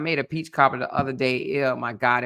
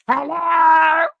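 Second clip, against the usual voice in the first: shrill shouted hello, much higher; loud high phone hello; 12 semitones higher, 8 dB louder than usual. Retold or imitated. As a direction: belt out hello like her. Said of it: "She answered the phone. Here she go".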